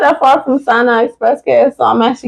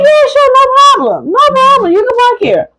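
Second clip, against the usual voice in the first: high, mock-friendly guard voice; squeaky high imitation of the guard; security guard voice; 13 semitones higher than usual. Quoting the security guard in a high mock voice. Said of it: "He said".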